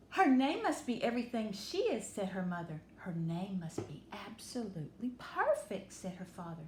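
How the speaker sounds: lively storybook read-aloud; bright, warm picture-book reading; reading to children. Acted. Teacher reading Chrysanthemum aloud to children.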